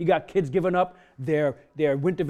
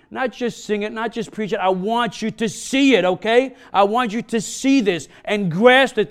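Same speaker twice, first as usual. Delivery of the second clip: louder, higher preaching, building fervour; preaching at full force; 4 semitones higher, 7 dB louder than usual. Acted. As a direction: preach it out, urging the flock to serve.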